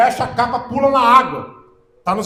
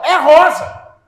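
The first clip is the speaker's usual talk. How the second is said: high, loud, certain; full conviction; 6 semitones higher, 9 dB louder, much slower than usual. Retold or imitated. Speaker confirms the colour after sowing doubt, full conviction. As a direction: declare it loudly without any doubt. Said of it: "Você tem convicção que é rosa?".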